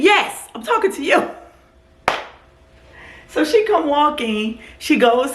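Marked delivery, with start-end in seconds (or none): 0.0-1.3 high, snippy mock reply; imitating curt driver. The driver answering the woman, high and snippy. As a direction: snap back as the driver. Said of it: "He said".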